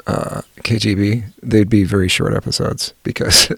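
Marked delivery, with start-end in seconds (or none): none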